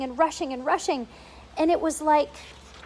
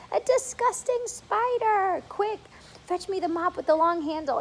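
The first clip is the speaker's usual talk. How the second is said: disgusted aunt; 7 semitones higher than usual. Acted. Aunt demanding the mop, high and disgusted. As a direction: recoil from the spider and bark for the mop.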